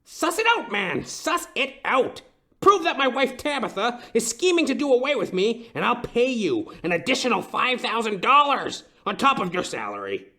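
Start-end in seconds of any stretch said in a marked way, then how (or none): 0.0-8.2 high, pressing demand; urgent demand. Acted. Client demanding the detective investigate, urgent. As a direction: push the detective hard, urgent.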